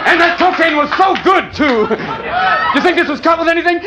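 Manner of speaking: manic shout. Acted. Comic recalling old cocaine days to the crowd, manic shouting. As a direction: whip it up into a frenzy.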